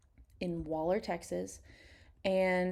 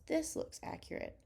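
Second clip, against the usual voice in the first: whispered aside; 9 semitones higher, 8 dB quieter than usual. Real, not acted. Doubtful aside on skin tones.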